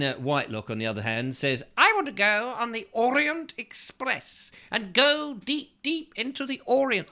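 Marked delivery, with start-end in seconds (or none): 1.8-7.1 mock grand voice. Reading Orient Express message.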